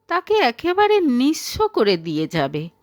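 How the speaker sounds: ordinary delivery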